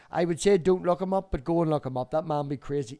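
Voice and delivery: normal talking voice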